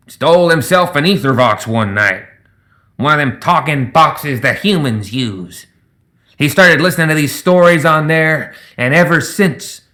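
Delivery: lightly voiced old gremlin, slowing; older gremlin gossip. A narrator's reading. Older gremlin tells the crewman about Zip, knowingly. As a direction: confide the story with a knowing drawl.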